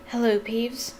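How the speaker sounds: ordinary delivery, nothing marked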